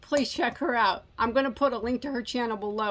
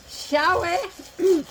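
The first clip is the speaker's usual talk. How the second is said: higher, louder teasing "Shall we?" with a put-on lilt; a higher, louder, playful "Shall we?" lilt; playful sing-song; 6 semitones higher, 4 dB louder than usual. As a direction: sing "Shall we?" out in a mock-fancy invitation. Repeated playful invitation.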